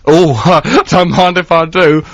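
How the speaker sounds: hammed-up camp voice; camp man. Camp man in a cockpit, mock coy delight. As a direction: put on a camp, giggly pilot. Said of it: "an overly camp man in a cockpit".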